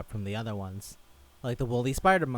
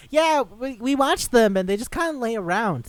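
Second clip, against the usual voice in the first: high, louder goofy expert voice; mock scientist; 10 semitones higher, 7 dB louder than usual. Quoting a baffled animal scientist, high goofy voice. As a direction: play the clueless expert up high.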